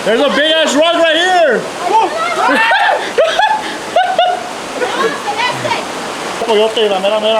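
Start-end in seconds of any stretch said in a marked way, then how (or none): 1.6-6.4 high shrieking laughter; screaming laugh. Real, not acted. Laughing fit after rock.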